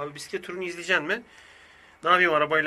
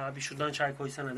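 offhand request; 4 semitones lower, 11 dB quieter than usual. Retold or imitated. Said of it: "der gibi".

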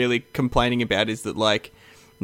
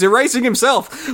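excited shout; 11 semitones higher, 4 dB louder than usual. Real, not acted. Watching Bob Ross video live.

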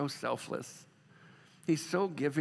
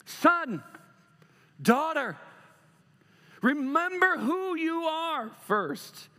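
slow, tender, drawn-out call; gentle reminder; 10 semitones higher, 4 dB louder, much slower than usual. Pretend. God reminding his children, slow tender voice. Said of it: "God's gonna slow you down and go".

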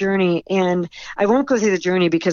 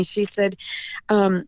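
ordinary talk, nothing marked

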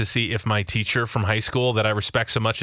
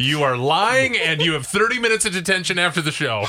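mock strict teacher; 5 semitones higher than usual. Mock teacher handing out detention.

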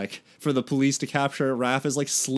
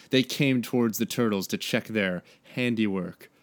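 ordinary talk

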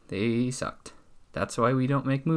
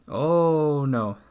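louder, raised, drawn-out "oh"; mock dismay; 4 semitones higher, 6 dB louder than usual. Cowboy reacts to the cow's kidnapping, mock dismay. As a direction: play up the dismay a little.

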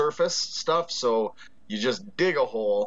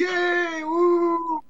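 high cheering whoops; 4 dB louder than usual. Real, not acted. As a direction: cheer like a crowd. Cheering the guest.

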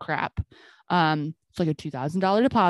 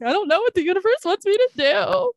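high, teary half-laugh, half-cry; wailing to her friend Kelsey, high and teary; laughing sob; 12 semitones higher, 3 dB louder than usual. Retold or imitated. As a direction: sob it out at the universe, laughing through tears. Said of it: "like, sobbing".

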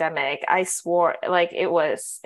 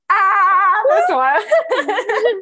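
loud, high laughing cry; laughing exclaim; 10 semitones higher, 8 dB louder, much slower than usual. Real, not acted. Signing off the podcast, she laughs loudly at her co-host. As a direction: burst out laughing at her co-host, much louder.

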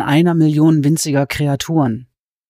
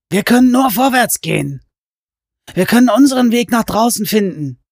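raised, urgent intense man; Hatcher voice; 6 semitones higher than usual. Acted. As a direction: push her onward fervently.

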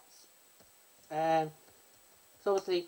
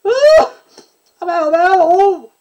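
big loud high oh, leaning on it hard; loud stressed oh, much higher and louder; emphatic oh; 10 semitones higher, 14 dB louder, slower than usual. Shown as a demonstration. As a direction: belt the oh with full emphasis. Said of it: "a bit of emphasis there".

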